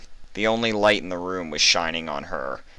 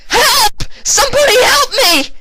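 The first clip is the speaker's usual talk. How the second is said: a very high, loud cry for help; hollering; 12 dB louder than usual. A narrator's reading. Vinyl being restrained, hollering for help. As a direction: holler it desperately. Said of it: "she hollered".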